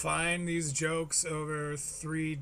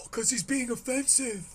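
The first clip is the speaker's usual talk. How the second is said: higher, pretend-shocked voice; mock scandalized; 6 semitones higher than usual. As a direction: act appalled, as if truly scandalized. Feigns shock at the clip.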